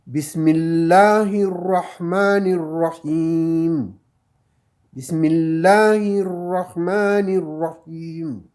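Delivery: Bismillahirrahmanirrahim, slow with the final m silent of vowel; slow Quran recitation. Shown as a demonstration. Teacher reading the verse aloud to the class, slow recitation. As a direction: recite it slowly and carefully. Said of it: "si on lit ça".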